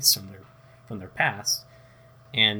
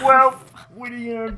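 squeaky joke voice; high mock voice; 11 dB louder, much faster than usual. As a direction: pitch up in a silly way. High put-on voice.

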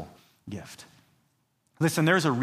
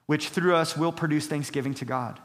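ordinary talk

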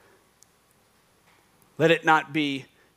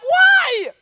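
squeaky high baffled friend; confused friend; 11 dB louder than usual. Retold or imitated. Friend hearing a strange request, lightly voiced confusion. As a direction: play the puzzled buddy, very high. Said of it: "Your friend's gonna be like".

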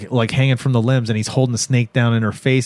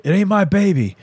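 put-on dude voice, pitched up; dismissive dude; 6 semitones higher than usual. Dude to crying woman on the bench, high mock denial. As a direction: imitate a man denying everything, high. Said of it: "the dude's like".

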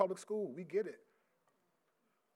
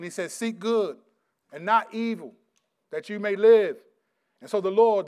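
full-force preaching; 5 semitones higher, 12 dB louder than usual. Real, not acted. Preacher reading the Psalms to his congregation, full-force preaching. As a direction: proclaim the verse to the whole church.

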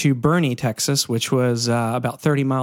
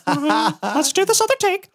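much higher squeaky cartoon mouse, mock concern; Mickey Mouse; 14 semitones higher than usual. Mickey Mouse checking on a kid in the studio, high squeaky voice. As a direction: peek in and fret in a cartoon squeak. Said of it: "Mickey Mouse is, like, peeking his head in".

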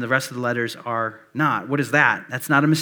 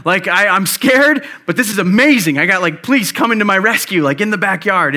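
higher, louder, thrilled and a bit scared; excited kid; 6 semitones higher, 6 dB louder than usual. Retold or imitated. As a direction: gush like a kid seeing police arrive. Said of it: "I was like".

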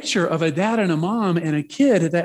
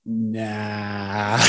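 low, drawn-out "naaah"; comic refusal; 7 semitones lower, 5 dB quieter than usual. Retold or imitated. Preacher declining God's prompting, comic low refusal. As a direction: drag it out low, brushing the idea off.

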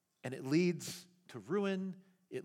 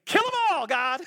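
high, loud pretend Moses demanding judgment; mock outburst; 8 semitones higher, 6 dB louder than usual. Pastor pretending to be Moses begging God, loud mock outburst. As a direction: blurt it out like a vengeful prophet. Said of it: "If I were Moses, I'd say".

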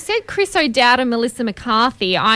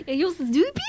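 soft, higher attempt at a Russian-German accent; Anna's accent badly imitated; 6 semitones higher, 5 dB quieter than usual. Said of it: "no, mine is not good".

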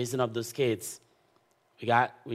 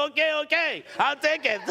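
high and louder, laughing; 4 dB louder than usual. Retold or imitated. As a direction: give in through laughter. Laughing accepting the house.

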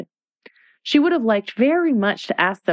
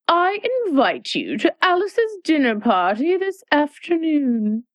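high haughty Red Queen; 9 semitones higher than usual. Acted.

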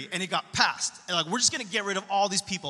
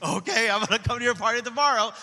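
eager king; 2 semitones higher, 5 dB louder, faster than usual. Acted. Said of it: "he's like".

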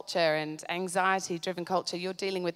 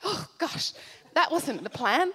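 ordinary delivery

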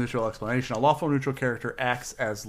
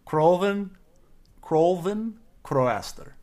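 put-on slow, higher Russian-accent name; 6 semitones higher, 3 dB louder, much slower than usual. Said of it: "It does sound Russian".

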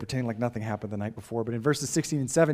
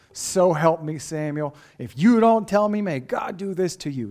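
louder, higher, menacing threat; hammed-up curse flung at Samuel; mock curse; 4 semitones higher, 6 dB louder than usual. Eli pretending to curse Samuel, a hammed-up loud threat. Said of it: "sounds like Eli's calling a curse on Samuel".

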